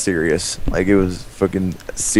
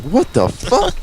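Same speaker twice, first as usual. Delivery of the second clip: mock startled kid, pitched way up; high squeaky shocked kid; 14 semitones higher, slower than usual. Touched stranger reacting, high squeaky surprise. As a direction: squeak it high with a rising yelp. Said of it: "and you're like".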